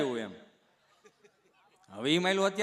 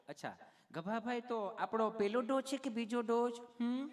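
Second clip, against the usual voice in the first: higher put-on nurse voice; sweetly asking nurse; 4 semitones higher, 6 dB quieter than usual. Nurse asking patient which dose, put-on sweetness. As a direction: coax the patient with a lilting question. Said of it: "તમે કેટલું પ્રેમથી પૂછો છો".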